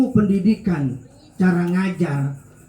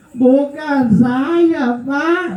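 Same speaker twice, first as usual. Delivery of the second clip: put-on high, very slow whimpering child; frightened pupil denying it to the teacher, near tears; 10 semitones higher, much slower than usual. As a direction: drag it out like a kid about to cry. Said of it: "malah mau nangis".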